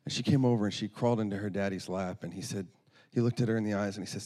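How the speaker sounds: normal talking voice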